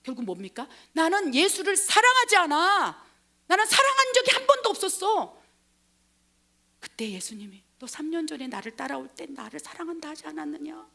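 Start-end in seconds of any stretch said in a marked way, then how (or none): 0.9-5.4 높고 크게 잡아떼기; 목청 높여 완강히 부인하는 연기. Acted. 베드로의 격한 부인. 7.8-10.9 작은 소리로 조용히 되묻기; 숨죽여 묻는 예수 연기; 나직한 물음. Acted. 예수가 베드로에게 조용히 물음.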